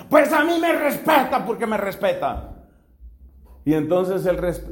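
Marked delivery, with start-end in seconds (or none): 0.1-2.7 high-pitched retold angry yell; mock yell. Sister shouting at her brother in rehearsal, hammed-up yell. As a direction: act out an indignant outburst, pitched up. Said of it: "y al rato vuelve y le grita".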